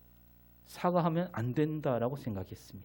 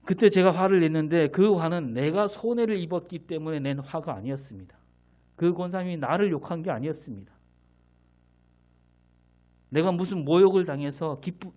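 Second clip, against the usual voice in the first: louder, higher pulpit fervor; preaching; 3 semitones higher, 6 dB louder than usual. Acted. Pastor insists to his congregation his anger was righteous, fervent preaching. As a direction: drive the point home to the congregation.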